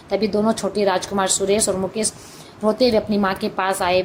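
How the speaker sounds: ordinary delivery, nothing marked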